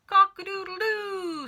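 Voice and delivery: high, loud rooster crow; squawking cockerel. A narrator's reading. The cockerel shooting up, loud high squawk. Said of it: "squawked the cockerel".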